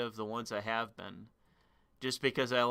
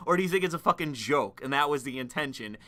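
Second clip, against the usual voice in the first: ordinary delivery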